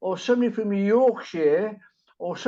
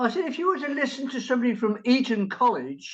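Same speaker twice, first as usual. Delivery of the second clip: ordinary talk, nothing marked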